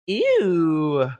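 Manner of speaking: mock disgusted ew from mom; a grossed-out little ew, mom's voice; imitating mom's ew. Daughter mimics mom reacting to a dead client. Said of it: "And mom does this".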